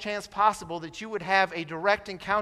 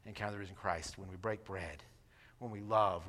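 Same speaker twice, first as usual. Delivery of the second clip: usual speaking voice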